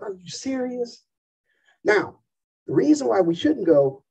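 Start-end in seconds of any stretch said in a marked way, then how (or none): 0.0-1.0 slow, high and hushed disbelief, imitating a parent; incredulous parent. Parent mocking bored kids, hushed incredulous. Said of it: "their same look would look at us like".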